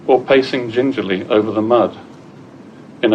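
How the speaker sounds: projected poem recital with deliberate pauses; poetry reading. Acted. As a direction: read the poem out clearly to the room. Reads second poem to audience.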